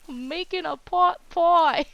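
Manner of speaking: slow, high, hammed-up Boston accent; attempted Boston accent. Retrying the man's funny reply from the trip, mock Boston accent. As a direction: stretch it out in a badly faked Boston drawl. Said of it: "I can't even do it".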